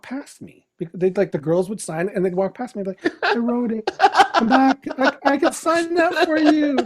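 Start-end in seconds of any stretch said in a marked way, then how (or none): 3.2-6.8 high, pleading call after them, laughing; mock plea to fans. Mock calling after passing fans.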